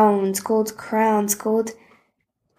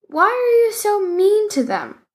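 put-on high, bold boy's challenge; high-pitched brave demand; 11 semitones higher than usual. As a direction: confront the bullies bravely. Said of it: "marched bravely up".

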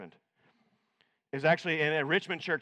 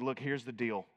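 quiet counsel; 5 semitones lower, 5 dB quieter than usual. Retold or imitated. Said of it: "And I said".

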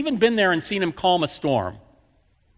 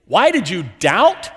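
louder, slow "Why did you doubt?" with a rising tune; incredulous repeat; 4 dB louder than usual. Retold or imitated. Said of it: "are you kidding?".

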